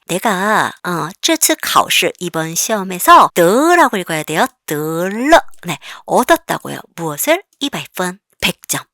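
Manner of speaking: ordinary delivery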